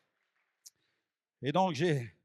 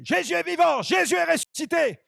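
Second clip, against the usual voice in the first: high, excited proclaiming shout; crying out; 8 semitones higher, 4 dB louder than usual. Retold or imitated. New convert running through the streets crying out the news, high shout. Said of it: "et je criais".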